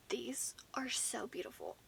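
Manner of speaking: hushed admiring whisper. Real, not acted. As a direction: breathe it out in a whisper. Admiring palette up close.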